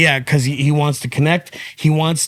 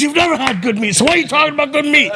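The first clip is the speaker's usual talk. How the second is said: much higher, racing indignant rant; Joey's pumped-up voice; 12 semitones higher, faster than usual. Retold or imitated. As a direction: wind yourself up and scold him fast. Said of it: "And he would say like that".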